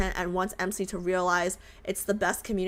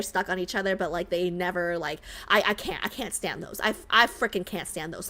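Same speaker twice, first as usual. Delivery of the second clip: ordinary talk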